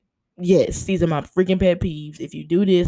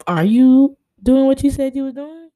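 suspicious questioning; 5 semitones higher than usual. Retold or imitated. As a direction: grill them like a suspicious detective. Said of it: "I'm just like".

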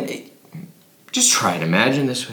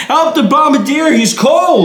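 laughing yell; 8 dB louder than usual. Pretend. Laughing through mock yelling.

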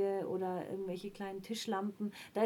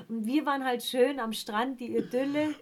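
ordinary talk

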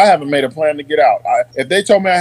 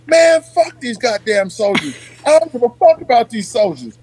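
higher-pitched, exasperated rant; retold indignant outburst; 5 semitones higher than usual. Sergeant retelling his rant about soldiers, indignant. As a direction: re-enact being fed up, without really shouting.

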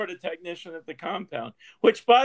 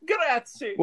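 hammed-up Italian accent, higher pitch; thick Italian accent; 7 semitones higher than usual. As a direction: say it like a showy Italian. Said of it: "Putting on his thickest fake Italian accent".

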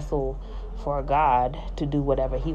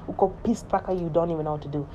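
ordinary delivery

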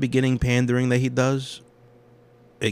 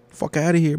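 higher and louder dismissal; indignant; 8 semitones higher, 5 dB louder than usual. Real, not acted. Dismissing the filmmaker out loud, indignant. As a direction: brush it off out loud. Repeated, then whispered.

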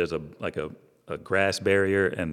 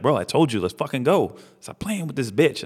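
retold exasperated friend, pitched higher; impatient urging; 6 semitones higher than usual. Friend urging his buddy to leave the girl, impatient. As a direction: imitate a buddy hurrying someone along, voice raised in pitch.

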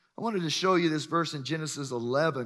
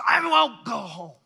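determined; 6 semitones higher, 7 dB louder than usual. Retold or imitated. Woman refuses crowd, retold.

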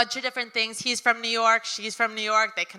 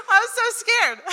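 real laughing cry, much higher and louder; laughing scared; 11 semitones higher, 3 dB louder than usual. Real, not acted. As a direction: laugh through the memory of the fright. Laughing about being scared.

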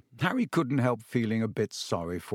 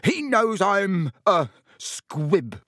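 higher and louder, fury breaking into shame; raging Filch; 6 semitones higher, 3 dB louder than usual. A narrator's reading. Caretaker raging at the boy, voice cracking. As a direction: choke out the confession.

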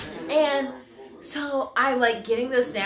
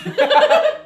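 high burst of laughter; loud laugh; 8 dB louder than usual. Real, not acted. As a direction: let out a big laugh. Laughing after singing.

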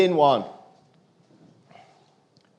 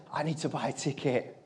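very soft, lowered, emotional voice; 4 semitones lower, 12 dB quieter than usual. Real, not acted. Retelling a kid who had nothing, quietly emotional. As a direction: say it quietly, moved by the words. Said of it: "it makes me emotional saying it".

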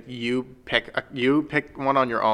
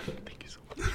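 soft breathy whisper into the mic; ASMR whisper; 14 dB quieter than usual. Pretend. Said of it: "his side of the channel is A S M R".

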